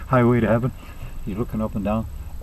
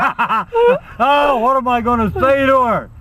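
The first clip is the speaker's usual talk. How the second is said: high-pitched comic wail; hammed-up dismay, pitch jumping up; mock panic; 8 semitones higher, slower than usual. Husband panics as wife nears.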